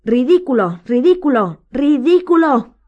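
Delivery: high, loud, frantic spell-casting; anguished cries, building to a peak; desperate moaning. A narrator's reading. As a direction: wail each attempt, the last one drawn out. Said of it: "gimió la señora Weasley".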